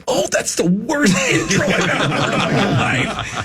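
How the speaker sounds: high-pitched protest breaking into laughter; mock outrage. Comic protesting his introduction, laughing mock outrage. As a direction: object in disbelief, laughing through it.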